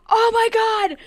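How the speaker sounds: high-pitched imitation of brother's thrill; high, excited exclamation; excited outburst. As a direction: cry out at a sudden thrilling sight. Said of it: "but he was like".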